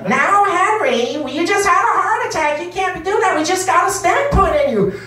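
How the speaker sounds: hammed-up nagging old wife; scolding old wife. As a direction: fuss over Henry's heart. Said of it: "use different voices".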